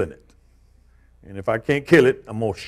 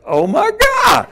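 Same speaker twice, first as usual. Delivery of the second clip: much higher, loud, slow cry of alarm; shocked shout; 4 dB louder, slower than usual. Retold or imitated. Grandpa reliving the moment he saw the snake, shouting. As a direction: scream it in sudden shock.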